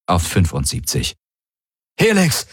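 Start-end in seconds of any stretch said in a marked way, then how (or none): 2.0-2.5 high, urgent call. A narrator's reading. Pilot calling for Helix in mid-battle, high urgent call. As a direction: call out sharply for the crewmate. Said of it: "rief er".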